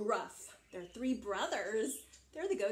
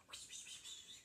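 wordless, hushed pss-wss whispering; secretive whispering; 17 dB quieter than usual. Acted. Little goat to his brothers so the troll won't hear, whispering. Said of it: "He's whispering so the troll doesn't hear".

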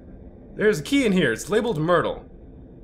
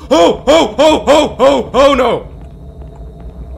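loud higher yelps of fear; frightened cries; 5 semitones higher, 7 dB louder, much slower than usual. Real, not acted. Streamer seeing the ghost again, frightened loud cries. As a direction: yelp at each shock.

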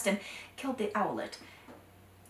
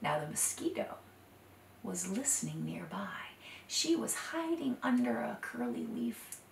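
whispered, soft storytelling; hushed narration; 10 dB quieter than usual. A narrator's reading. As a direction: draw listeners close. Secretive mosquito hiding.

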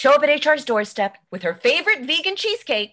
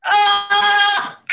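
sudden loud yelp, higher; loud pained yelp; 7 semitones higher, 7 dB louder than usual. Acted. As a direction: cry out as you slip and fall. Slips on cat mess.

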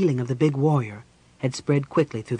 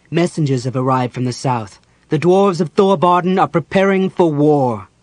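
impassioned proclamation swelling louder; intense passion; 8 dB louder than usual. A narrator's reading. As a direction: build to a ringing, urgent announcement. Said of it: "Raistlin spoke with such intense passion".

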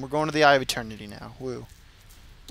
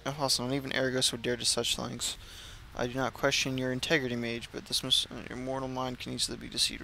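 quest text reading; 2 semitones lower, 8 dB quieter than usual. Acted. Gamer reads the quest text aloud, softly and evenly. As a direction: read the quest dialogue quietly to yourself.

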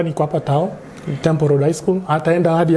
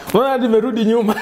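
laughing, high and fast; 8 semitones higher, much faster than usual. Real, not acted. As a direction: giggle through the question. Laughing at the question.

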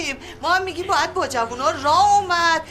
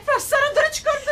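alarmed cry; 9 semitones higher, faster than usual. Acted. Aunt alarmed at Keyvan.

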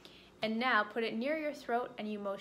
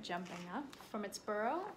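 ordinary delivery

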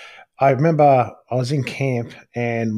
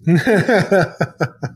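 real laugh, pitched up; 6 semitones higher, 3 dB louder than usual. Real, not acted. Answering his cohost's joke with a real laugh. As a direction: laugh along freely.

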